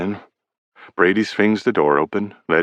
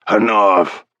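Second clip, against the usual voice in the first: furious coach; 2 semitones higher, 5 dB louder than usual. A narrator's reading. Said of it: "slams his fist down on the desk".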